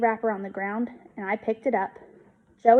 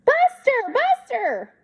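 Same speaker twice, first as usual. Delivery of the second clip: high, loud frantic calls for the dog; screaming; 5 dB louder, slower than usual. A narrator's reading. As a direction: scream after a runaway dog. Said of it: "I heard a scream".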